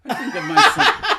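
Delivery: high, loud words broken by laughter; giggling protest; laughing. Real, not acted. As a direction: laugh out the line. Laughing at the pervert joke.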